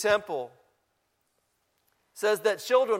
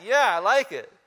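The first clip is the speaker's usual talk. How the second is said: ordinary talk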